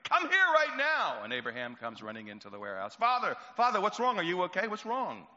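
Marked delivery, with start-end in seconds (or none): 0.0-1.2 much higher and louder, screaming for Abraham; the father bellowing over his smashed business; furious scream. Acted. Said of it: "this blood-curdling scream". 3.0-5.3 put-on higher, louder worried young voice; anxious son. Son answering father's scream.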